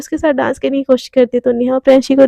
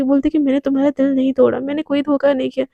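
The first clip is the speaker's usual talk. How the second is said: ordinary delivery, nothing marked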